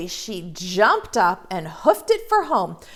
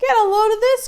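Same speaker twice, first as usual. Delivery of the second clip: loud, high call from the next room; loud call across the house; 12 semitones higher, 7 dB louder, faster than usual. Acted. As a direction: call out to the others. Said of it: "called Papa Pear".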